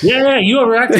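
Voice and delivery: loud, high, laughing yeah yeah; laughing retort. Retold or imitated. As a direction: daughter laughing at her dad's overreaction.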